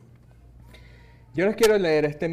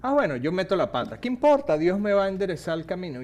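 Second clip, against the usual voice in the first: fast, flippant, higher and louder, like someone shrugging off a mistake; careless mock quote; 5 semitones higher, 5 dB louder, faster than usual. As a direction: toss it off as if nothing matters. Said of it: "saben por qué la gente lo usa mucho".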